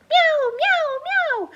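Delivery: high sung meows; cat found by Jesse meows; meowing cat. Acted. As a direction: meow like a little cat. Cat's sound.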